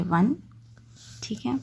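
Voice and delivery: ordinary talk, nothing marked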